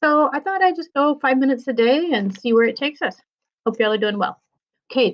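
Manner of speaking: ordinary delivery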